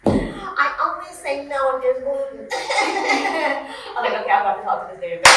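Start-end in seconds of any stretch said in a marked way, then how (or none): 4.2-5.2 fast fed-up mutter. Retold or imitated. His fed-up inner thought.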